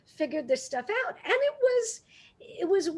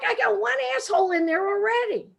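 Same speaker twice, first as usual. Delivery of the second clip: mock yell back; 6 semitones higher, 3 dB louder than usual. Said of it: "And I yell back".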